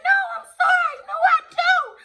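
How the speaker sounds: imitating the thief screaming for mercy; shrieking plea. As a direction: scream please like you are terrified.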